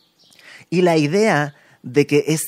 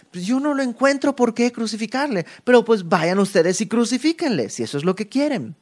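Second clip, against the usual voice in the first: higher, lightly scornful Pilate; a bit mocking; 7 semitones higher than usual. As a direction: toss it back at them with mild mockery. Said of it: "Un poco burlándose".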